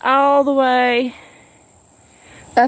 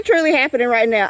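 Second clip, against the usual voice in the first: ordinary talk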